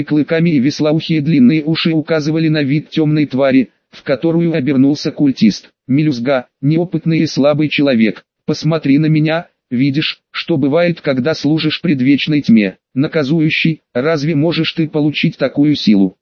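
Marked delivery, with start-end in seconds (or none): none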